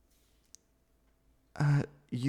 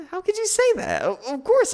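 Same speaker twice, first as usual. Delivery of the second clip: high, loud cry of disbelief; shocked outburst; 7 dB louder than usual. Acted. To the friend who doubts, a high shocked cry. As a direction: blurt it out, stung and shocked.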